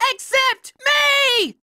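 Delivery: high, slow, shouted boast; triumphant shout. Acted. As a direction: stretch it out as a battle cry. Boast to villain.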